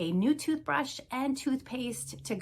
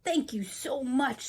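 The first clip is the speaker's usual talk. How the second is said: grateful traveler; 5 semitones higher than usual. A narrator's reading. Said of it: "says traveler".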